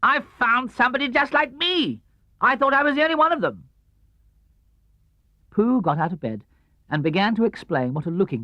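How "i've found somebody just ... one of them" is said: high, quick, amazed Tigger voice; excited Tigger. A narrator's reading. Tigger tells Pooh about the mirror, high and excited. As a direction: Tigger thrilled at his own reflection.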